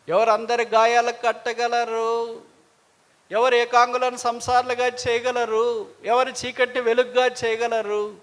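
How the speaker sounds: high, loud rhetorical questions; forceful preaching. Acted. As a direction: ring out each question to the congregation.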